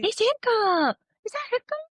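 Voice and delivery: squealing high, delighted; excited pet talk. Real, not acted. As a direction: squeal at the puppy.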